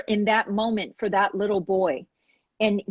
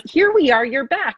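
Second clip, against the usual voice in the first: excited welcome; 6 semitones higher, 5 dB louder than usual. Real, not acted. Host greets returning guest.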